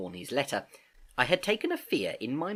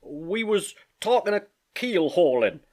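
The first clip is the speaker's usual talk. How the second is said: higher, slow sailor voice; Morgan, cornered; 8 semitones higher, 4 dB louder than usual. Acted. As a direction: answer haltingly in a raised pitch. Morgan answers the interrogation.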